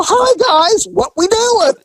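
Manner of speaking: high, sing-song friendly hello; mock-cheerful greeting. Officer greets the gamblers with mock cheer. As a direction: play innocent and chipper.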